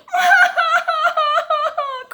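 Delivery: very loud, high mock wail; little girl crying. A narrator's reading. Little girl cries in the squeaky bed, loud mock wail. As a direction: burst into a pulsing storybook bawl. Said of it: "cried the little girl".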